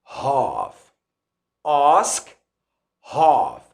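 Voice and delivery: "Have" and "Ask" low, with the A of father; low British back vowel in "Ask" and "have"; British broad A. Shown as a demonstration. Teacher drilling both changed words, British vowels. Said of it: "the British accent when you pronounce these two words".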